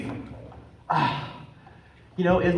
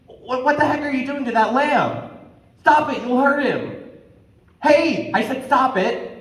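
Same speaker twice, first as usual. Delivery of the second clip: calling out; 7 semitones higher than usual. Acted. Shouting at the lion.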